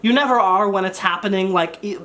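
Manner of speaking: usual speaking voice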